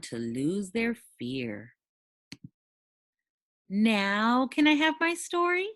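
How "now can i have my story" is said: much higher pleading dragon voice; begging dragon. Acted. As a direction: plead like a child for the story. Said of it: "begged the dragon on his knees".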